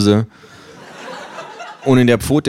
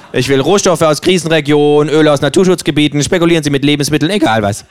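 höher, fordernde Känguru-Stimme; freches Känguru; 7 semitones higher than usual. Acted. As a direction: spiel das Känguru dreist und provokant. Känguru hält zynischen Monolog. (höhere, dreist fordernde Känguru-Stimme)

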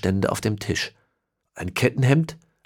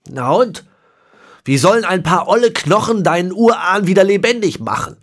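higher, louder scornful question; contemptuous; 10 semitones higher, 6 dB louder than usual. A narrator's reading. Manfred scorning the old bones, loud and sneering. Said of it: "fragte Manfred verächtlich".

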